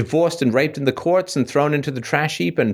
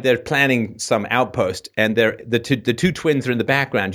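ordinary talk, nothing marked

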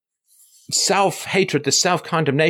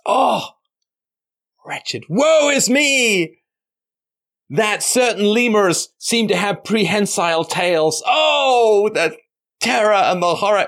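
slow, much higher, hammed-up woe; dramatic sigh; 8 semitones higher, 4 dB louder, slower than usual. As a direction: wail it like a tragic hero. Mock lament, woe is me.

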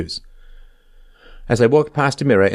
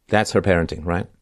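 normal talking voice

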